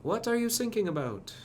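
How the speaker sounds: high German-accented punchline. Shown as a demonstration. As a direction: repeat the joke in a German accent, pitched high. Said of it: "repeats punchline".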